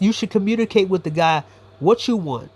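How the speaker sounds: usual speaking voice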